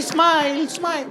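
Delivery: high, sing-song "smile"; mock sweet coaxing. Comic mimics girlfriend coaxing a smile from him. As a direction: coo it up high.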